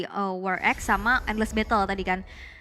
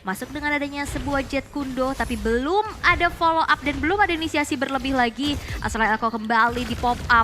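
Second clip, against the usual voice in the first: rising hype on the big play; esports caster; 4 semitones higher, 3 dB louder than usual. Acted. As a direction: call the action, build to the kill. Casts the match play-by-play. (komentator esports yang bersemangat)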